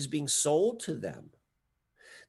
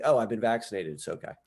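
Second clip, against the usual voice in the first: straight-faced; 6 semitones lower, faster than usual. Retold or imitated. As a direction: slip the joke out deadpan, dropping away at the end. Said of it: "say it with a straight face".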